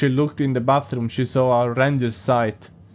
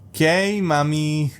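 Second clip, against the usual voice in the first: put-on childish voice; 2 semitones higher, slower than usual. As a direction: sound like a five-year-old calling his mommy. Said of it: "What the fuck is he speaking like this now?".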